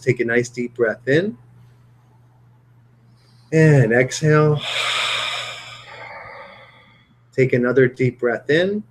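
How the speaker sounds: low, calm meditation-guide voice; guided breathing. Shown as a demonstration. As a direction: ease them through each breath, leaving long pauses. Leads closing breathing exercise.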